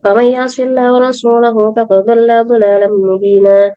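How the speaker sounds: ordinary talk, nothing marked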